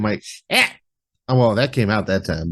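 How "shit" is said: curt mock curse. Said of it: "Sometimes I just leave out the damn".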